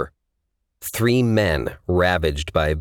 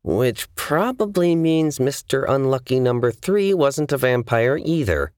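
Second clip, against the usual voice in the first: high, tense sarcasm through gritted teeth; 8 semitones higher than usual. A narrator's reading. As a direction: grit it out in irritation. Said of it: "Aura gritted out".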